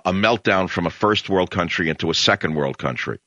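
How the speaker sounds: ordinary talk, nothing marked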